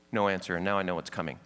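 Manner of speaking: ordinary talk, nothing marked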